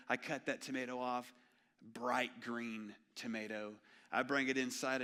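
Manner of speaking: normal talking voice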